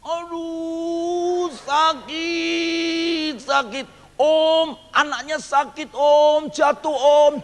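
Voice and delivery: high, wailing mock pain; fake crying. Boy fakes a fall, wailing to the uncles. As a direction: ham up the hurt, crying out. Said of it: "saya menangis".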